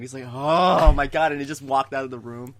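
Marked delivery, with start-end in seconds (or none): 0.3-1.3 loud, drawn-out mock groan of exasperation; annoyed. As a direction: drag it out, playfully fed up. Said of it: "He was getting so annoyed".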